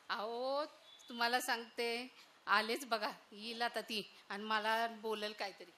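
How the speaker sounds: soft, confiding aside to the audience; hushed aside. Acted. Mother-in-law's hushed aside. (हळू आवाजात बाजूला बोलणे)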